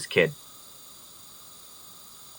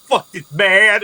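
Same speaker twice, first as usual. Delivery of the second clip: pissed-off barbarian; 7 semitones higher, 6 dB louder, slower than usual. Acted. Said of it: "look, like, all fucking pissed".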